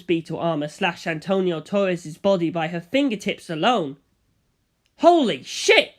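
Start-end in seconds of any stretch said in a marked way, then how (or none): 5.0-5.9 high loud startled exclamation; shocked shout. A narrator's reading. Narrator seeing Elizabeth leap, shouting in shock. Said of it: "I shouted".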